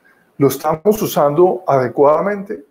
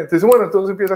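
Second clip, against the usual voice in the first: ordinary delivery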